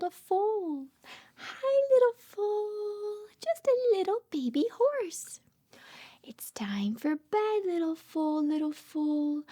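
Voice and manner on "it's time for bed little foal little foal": hushed, singsong lullaby lines; bedtime story reading. Acted. Mother reads a bedtime book to her baby, hushed and singsong. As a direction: lull the baby toward sleep.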